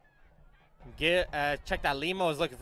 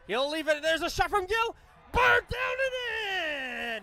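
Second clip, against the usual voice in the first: goal call; 13 semitones higher, slower than usual. Real, not acted. Goal call in live commentary.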